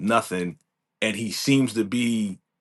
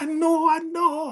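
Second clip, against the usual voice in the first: high mock voice. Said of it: "He's like".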